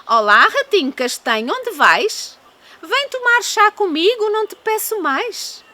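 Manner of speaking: high, hooting owl voice. Acted. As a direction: invite like a sweet old owl. Owl invites the mouse.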